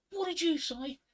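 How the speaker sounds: high-pitched, loud mock outrage; shocked barmaid. Barmaid challenging a cheeky customer in a joke, mock shock. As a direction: play an affronted barmaid, pitch shooting up. Barmaid reacts to drunk's line.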